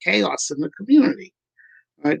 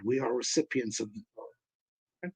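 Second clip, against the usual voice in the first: softer, dropped echo of the saying; quiet restatement; 4 semitones lower, 15 dB quieter than usual. Shown as a demonstration. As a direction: let the repeat fall away softly. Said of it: "Year round".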